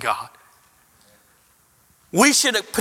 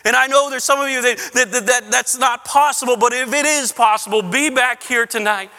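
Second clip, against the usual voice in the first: urgent preaching; 4 semitones higher than usual. Acted. Urgent pastoral challenge.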